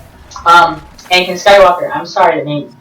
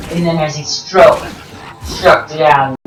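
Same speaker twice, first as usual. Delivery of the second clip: ordinary delivery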